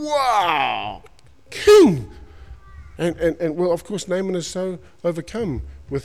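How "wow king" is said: amazed shout. Acted. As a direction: call out in astonishment. Mates amazed at healing.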